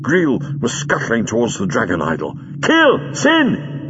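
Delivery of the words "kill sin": very high screamed command; villain screaming orders. A narrator's reading. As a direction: shriek each word as a separate order. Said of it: "he screamed".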